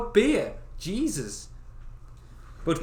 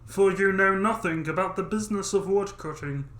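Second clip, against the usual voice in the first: stern father voice; 5 semitones higher, 3 dB louder than usual. Acted. Father warning Dummling about the woods. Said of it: "Why does he have that voice?".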